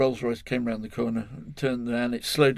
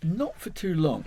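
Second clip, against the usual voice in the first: ordinary talk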